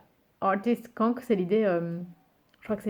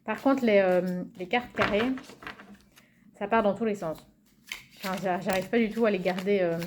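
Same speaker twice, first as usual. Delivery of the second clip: ordinary delivery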